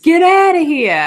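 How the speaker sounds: loud, high, laughing Brooklyn accent; heavy Brooklyn accent. Shown as a demonstration. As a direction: ham up a thick Brooklyn accent, half laughing. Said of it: "they think that really heavy Brooklyn accent".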